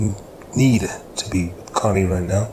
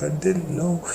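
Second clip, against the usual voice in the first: high-pitched apologetic plea; acted-out sheepish protest; flustered apology; 10 semitones higher than usual. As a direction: plead innocence in a raised pitch. Oscar apologizes for upsetting her.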